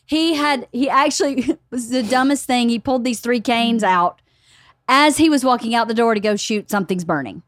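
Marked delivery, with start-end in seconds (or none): none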